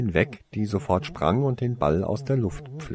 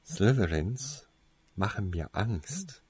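slow, much softer, a touch of awe; slowly; 6 dB quieter, slower than usual. Acted. Alex admits slowly that Slytherins scare him. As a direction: let it out slowly and quietly. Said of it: "sagte Alex schließlich langsam".

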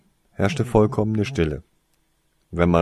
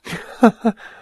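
loud high burst of laughter; laughing; 13 semitones higher, 9 dB louder than usual. Acted. Toriesa laughs off reporting it to Snape. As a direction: laugh at the suggestion. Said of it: "lachte Toriesa".

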